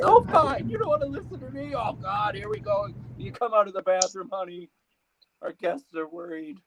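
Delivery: high-pitched put-on nagging voice; aggrieved spouse. God's partner nags God at home, high and aggrieved. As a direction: complain in a high, hurt voice.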